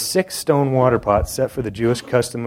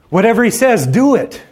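high, loud ringing order; emphatic command; 11 semitones higher, 8 dB louder than usual. Retold or imitated. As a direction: call it out to the servants with full force. Mary commanding the servants.

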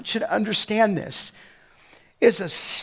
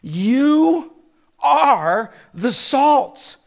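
You and are drawn out, louder, higher; emphatic statement; 4 semitones higher, 4 dB louder, much slower than usual. Shown as a demonstration. Pastor declaring to believers they are salt. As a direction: preach it slowly, pressing every word. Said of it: "He's telling us what you already are".

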